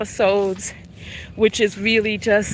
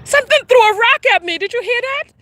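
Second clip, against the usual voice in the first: much higher, fast startled outburst; 11 semitones higher, 3 dB louder, faster than usual. Real, not acted. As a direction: jump at a rock hitting you. Startled by a thrown rock.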